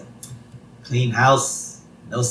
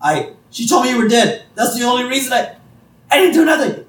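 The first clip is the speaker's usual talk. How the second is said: much higher, louder, desperate Moe; panicked Moe; 11 semitones higher, 5 dB louder than usual. Retold or imitated. Moe blaming Marge before running off, loud and panicky. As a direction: scramble to deny everything in a high voice. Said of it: "He's like".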